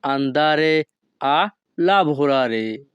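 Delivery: slow, careful teacher pronunciation; very slow, deliberate Italian; slow Italian model phrase. Shown as a demonstration. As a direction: lay out each syllable slowly for the learner. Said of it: "তখন আমাদেরকে বলতে হবে".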